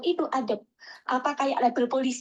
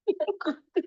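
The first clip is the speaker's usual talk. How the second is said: short laugh; 7 semitones higher than usual. Real, not acted. Laughs retelling the scam.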